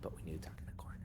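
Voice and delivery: hushed conspiratorial whisper; secret whisper. Acted. Kid whispers to friends about a secret plan. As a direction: whisper it so the adult can't hear. Huddling secretly away from Aisha.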